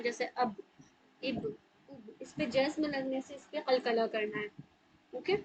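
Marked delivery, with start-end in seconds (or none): 0.0-2.2 अब इब उब with the ब bounced hard; qalqalah demo. Shown as a demonstration. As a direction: give अब इब उब a jolt on the final ब. (ब पर कलकला के साथ अब इब उब)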